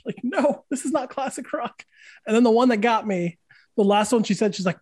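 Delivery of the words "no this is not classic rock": high, pained no; exasperated protest. Retold or imitated. Guitar teacher correcting a student, exasperated high protest. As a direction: protest like she's wounded you.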